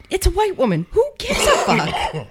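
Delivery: very high-pitched, incredulous squawk; high mock-exasperated outburst. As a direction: blurt it way up high. Mocking the caller's outrage.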